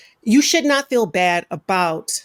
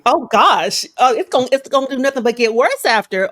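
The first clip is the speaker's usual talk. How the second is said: alarmed exclamation; 4 semitones higher, 5 dB louder than usual. Retold or imitated. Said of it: "I'm like".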